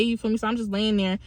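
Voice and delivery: ordinary talk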